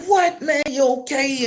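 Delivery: high-pitched shocked questioning; acted alarmed concern. Man checking on his injured brother, acted alarmed concern. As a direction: ask in a high, startled voice. Acts worried reaction to brother.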